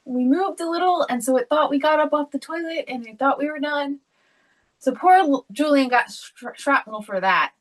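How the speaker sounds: ordinary delivery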